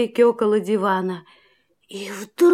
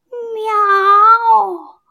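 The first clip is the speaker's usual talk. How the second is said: kitten meow; 6 semitones higher, 4 dB louder than usual. A narrator's reading. Hidden kitten meows.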